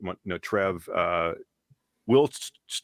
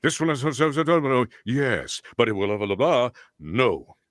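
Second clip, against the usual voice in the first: villain no tacked on; 3 semitones higher, 3 dB louder than usual. Shown as a demonstration. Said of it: "And I said".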